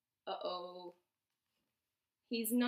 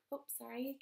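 ordinary delivery, nothing marked

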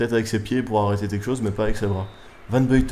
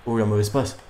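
ordinary talk

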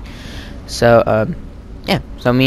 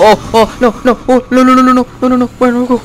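high, loud panicked no no; 12 semitones higher, 4 dB louder than usual. Real, not acted. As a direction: panic as the zombies close in. Swarmed by zombies in game.

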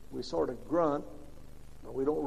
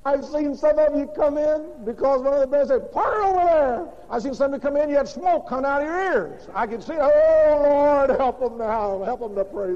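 loud, high fervent preaching; preaching at full force; 8 semitones higher, 7 dB louder than usual. Acted. Preacher praying over the congregation, loud and fervent. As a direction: cry out the prayer over the congregation.

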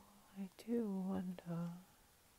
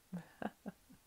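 whispery laugh in short bursts; hushed laugh. Acted. Vampire mocks her captive's short life, hushed laugh. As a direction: laugh under your breath at your prey.

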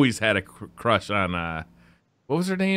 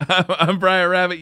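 laughing intro; 7 semitones higher, 5 dB louder, slower than usual. Real, not acted. Laughing self-introduction, slow.

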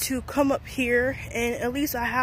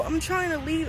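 ordinary talk

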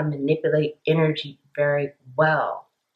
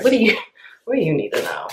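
ordinary talk

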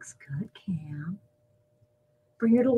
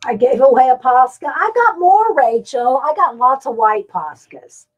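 calling out; 4 semitones higher, 10 dB louder than usual. Real, not acted. Excited call across room.

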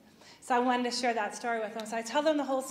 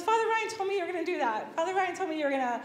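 higher, louder and fast, tumbling out; excited retelling; 6 semitones higher, 3 dB louder, faster than usual. Retold or imitated. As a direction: rush it out, thrilled. Retelling friend's excited prediction.

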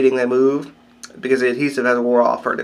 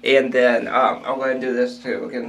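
lip pulled aside by finger; speaking with finger in mouth. Real, not acted. Seen 3: index finger pulls lower lip and cheek while speaking.

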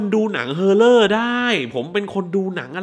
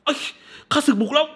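high panicked cry that the enemy is coming; mock alarm, pitched way up; panicked victim yell; 11 semitones higher than usual. Man in pain cries out in mock panic. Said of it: "ไอ้ผู้ชายคนเนี้ยแม่งแบบ".

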